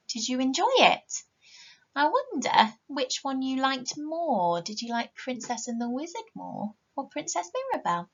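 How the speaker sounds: ordinary delivery, nothing marked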